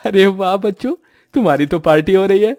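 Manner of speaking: high, loud, delighted father voice; cheerful father. Acted. Father coming home to find the children feasting, high and loud with delight. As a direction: burst in beaming at the children's feast.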